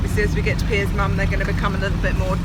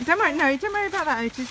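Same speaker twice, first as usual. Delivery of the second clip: reassuring; 8 semitones higher, faster than usual. Real, not acted. Reassuring toddler.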